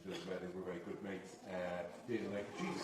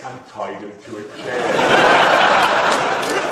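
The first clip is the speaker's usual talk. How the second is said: words dragged out through laughter; cracking up while telling a man's wild story; laughing through it; 6 semitones lower, 13 dB louder, much slower than usual. Real, not acted. Laughing helplessly at the end of the story.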